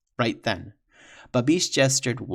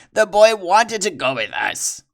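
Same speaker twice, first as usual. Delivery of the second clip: higher, louder pleading villain voice; sniveling excuse; blubbering; 8 semitones higher, 9 dB louder than usual. Acted. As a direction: blubber it out, desperate. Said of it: "Babish blubbered".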